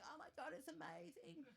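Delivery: hammed-up fawning over the dessert; gushing praise. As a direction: friends fawning over the tiramisu. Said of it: "They're all going".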